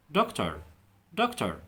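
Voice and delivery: doctor in an American accent, ending with a clear r. Shown as a demonstration. Teacher shows learners American doctor. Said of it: "في الامريكان هينطقها دكتور".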